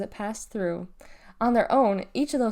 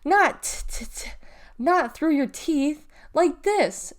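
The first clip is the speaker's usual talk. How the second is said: high, slightly raised scolding voice; shouted correction; 6 semitones higher, 3 dB louder, much slower than usual. A narrator's reading. Girl corrects her brother's spitting, raised voice. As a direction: correct him insistently, pitched up. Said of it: "she shouted".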